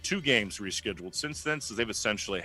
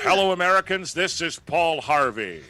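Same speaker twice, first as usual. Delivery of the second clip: Paul Harvey voice; 7 semitones higher, 7 dB louder than usual. Retold or imitated. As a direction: boom it out like a vintage newsman. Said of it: "I can do that on my own".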